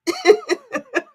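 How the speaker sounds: high burst of laughter; real laugh. Real, not acted. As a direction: break up laughing at yourself. Laughs at own impression.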